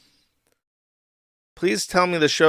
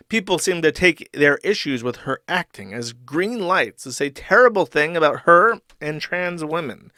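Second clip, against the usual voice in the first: ordinary talk, nothing marked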